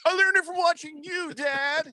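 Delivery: put-on very high-pitched kid voice; hammed-up anti-drug ad child; accusing commercial kid. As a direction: throw it back at Dad, way up high. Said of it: "Like the old drug commercial".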